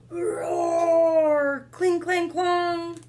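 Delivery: roar, then ring the swords out; battle sounds. Acted. Reader voicing the king and dragon's battle.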